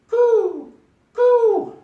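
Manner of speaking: a shrill mock bird call; seagull coo. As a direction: squeak it very high like a bird. Imitating a seagull cry.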